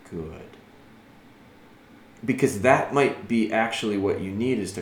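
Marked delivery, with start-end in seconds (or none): 0.1-0.6 soft, low, quiet "Good."; just a calm, understated "Good."; calm praise. Shown as a demonstration. Trainer praising the dog calmly, softly. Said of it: "You might just have to say".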